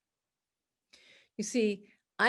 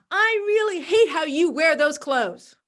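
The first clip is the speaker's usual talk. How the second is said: higher, louder snide jab; snide insult; 5 semitones higher, 5 dB louder than usual. Pretend. A critic insulting someone's clothes, snide. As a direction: sneer at their outfit.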